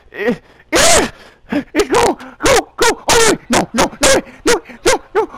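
high, loud go and no cries; panicked yelling on the bases; frantic shouting. Real, not acted. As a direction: yell at the runner.

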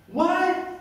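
ordinary talk

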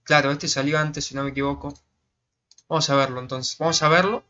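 ordinary talk, nothing marked

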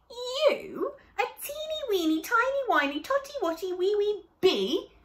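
put-on high, loud scoffing animals, rattling off the teeny weeny bits fast; mocking disbelief. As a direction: tease the tiny bee with squeaky scorn. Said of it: "they exclaimed".

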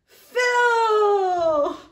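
loud, high, laughing cry of the name; laughing shout. Real, not acted. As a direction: shriek the name, mortified and amused. Reading diary, embarrassed shout.

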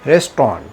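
রেস্টুরেন্ট as "res-tron", two syllables; correct pronunciation. Shown as a demonstration. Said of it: "সঠিক উচ্চারণ কী বলবো".

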